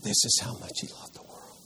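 hushed, amazed whisper; wide-eyed whisper. Shown as a demonstration. Teacher playing wide-eyed John for the group, awed whisper. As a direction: whisper it as if stunned by the love. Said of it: "wide-eyed John".

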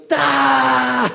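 a sudden high, loud "Da!"; mock anguished yell. Teenager finds his crumpled license in the jeans, loud mock yelp. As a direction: blurt it out like a teen in dismay. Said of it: "and he goes".

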